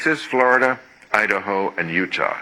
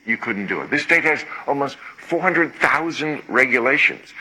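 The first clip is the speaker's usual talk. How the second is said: normal talking voice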